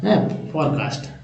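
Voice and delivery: फोरकास्ट said slowly and softly, a lingering demo; slow demo word. Shown as a demonstration. Teacher models फोरकास्ट for students, slow and soft. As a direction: draw out फोरकास्ट as por-kast. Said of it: "फोरकास्ट समझ में आ जाता है".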